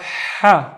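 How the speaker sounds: "ha" with a strong out-of-breath h; "ha" as if running out of breath; breathy Arabic ha. Shown as a demonstration. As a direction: push "ha" out loudly on a breath. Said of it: "this is the one running out of breath".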